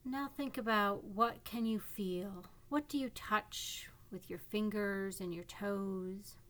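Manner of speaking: calm guiding voice with long pauses; guided meditation. Acted. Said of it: "This is a guided imagery meditation".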